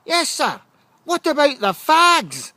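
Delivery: high, loud and slow protest; indignant protest. Retold or imitated. Boy protests to the headmaster about the smokers. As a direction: insist on the unfairness at full volume. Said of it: "I said".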